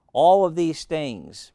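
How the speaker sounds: loud, slow and drawn out; preaching loud. Acted. Preacher calling the congregation's sins out loud and slow. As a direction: call it out slowly to the whole church.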